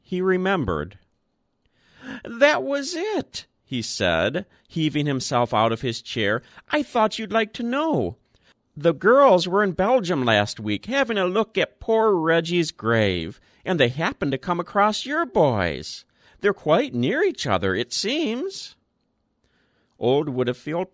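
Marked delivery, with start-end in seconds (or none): 2.2-3.5 put-on high piping old man; a thin old voice brightening; higher, eager old man; old man remembering. A narrator's reading. 6.7-18.8 put-on lightly raised, high old man's voice; an old man passing on news, higher and louder; high chatty old man; frail old man. A narrator's reading. Old Woodfield's news of graves.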